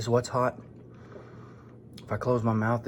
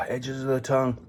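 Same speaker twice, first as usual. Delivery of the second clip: mouth burning from spicy food; 2 semitones higher than usual. Real, not acted. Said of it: "I even feel a little bit heat".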